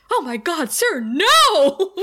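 hammed-up frightened nurse shouting; panicked nurse. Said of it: "So the nurse is like".

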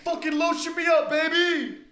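very high, loud whoop; mock shout. Joking about their own live show, mock stage shout. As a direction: holler it like a rowdy performer on stage.

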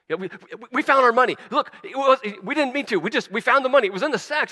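high, loud, tumbling over the words; frantic brothers talking over each other; panicked stuttering. Acted. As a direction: blurt it out in a panic, stammering. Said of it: "just stuttering all the way through".

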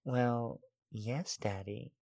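very soft, playful admission; coy and hushed, a hint of a giggle; giggly tease. A narrator's reading. Woman admitting to her lover she will tease, softly playful. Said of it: "I say again with another giggle".

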